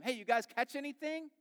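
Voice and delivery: high, loud hail across water; yelling out. Acted. Stranger on the shore to men in a boat, hailing yell. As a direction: call out to people far off. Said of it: "he yells to them".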